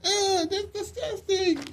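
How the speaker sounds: very high, slow drawn-out squeal; mock disgust. "my reaction" to men, high slow mock-disgusted squeal. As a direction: drag it out high in hammed-up revulsion.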